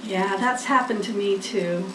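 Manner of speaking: ordinary delivery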